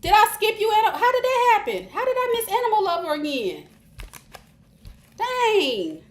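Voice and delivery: high, loud, incredulous questions; real high-pitched disbelief; dismayed surprise. Real, not acted. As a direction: cry out at your own slip-up. Realizes she skipped a viewer.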